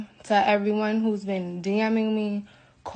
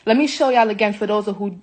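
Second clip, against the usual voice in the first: ordinary talk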